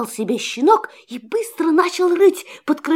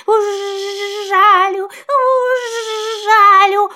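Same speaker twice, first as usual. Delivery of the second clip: buzzing bee voice; 6 semitones higher, slower than usual. A narrator's reading. Bee chases puppy.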